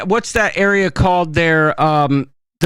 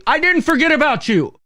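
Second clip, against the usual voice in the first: higher-pitched condescending quote; 7 semitones higher than usual. Retold or imitated. Cruise passenger imitating a bartender who kept him waiting, condescending. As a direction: mimic him talking down to you. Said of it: "that's just my condescending voice".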